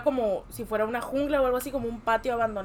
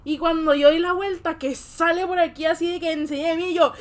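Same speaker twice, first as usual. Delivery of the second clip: ordinary talk, nothing marked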